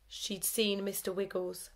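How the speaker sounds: ordinary delivery, nothing marked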